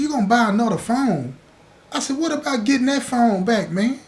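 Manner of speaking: can't believe what he just heard; incredulous. Retold or imitated. To Jamaica, who will just buy another phone, incredulous.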